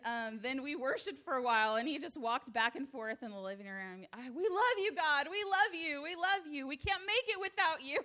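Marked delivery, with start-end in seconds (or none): 4.1-8.0 put-on fervent child worship. Boy worshiping around living room.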